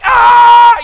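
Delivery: high, loud scream of terror; panicked scream. Retold or imitated. Father staring at his choking baby, panicked scream. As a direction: let out a wordless shriek.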